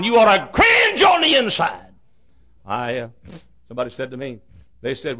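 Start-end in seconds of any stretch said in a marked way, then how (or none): 0.0-1.9 loud, slow, high preacher cry; emphatic shout. Real, not acted. Preacher to congregation, slow rising shout. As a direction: drive it home to the congregation.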